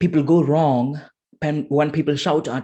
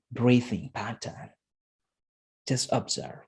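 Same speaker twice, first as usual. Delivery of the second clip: guided meditation; 3 semitones lower, 4 dB quieter than usual. Shown as a demonstration. Coach guiding listeners to close eyes, soft meditation voice.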